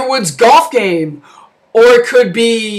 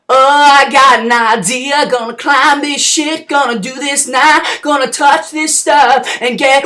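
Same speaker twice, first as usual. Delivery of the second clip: slow, drawn-out put-on voice; dim hero planning; slower than usual. Chelios eyeing the telephone pole, slow labored mock voice. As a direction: drag out each step of the plan.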